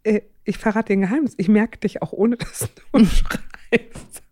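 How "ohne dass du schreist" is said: laughing. Real, not acted. Laughing while telling son.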